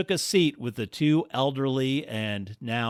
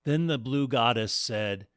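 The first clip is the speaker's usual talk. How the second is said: ordinary delivery, nothing marked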